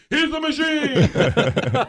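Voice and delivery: high, slow, drawn-out cheer; loud, hammed-up group cheer; gangsters' cheer. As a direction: stretch it out like a roaring drunk crowd. Gangsters cheering back, acted out.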